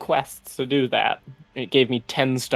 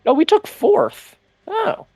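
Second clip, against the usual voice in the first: much higher, drawn-out surprise; surprised; 4 dB louder, slower than usual. Real, not acted. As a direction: caught off guard. Discovers the match placement.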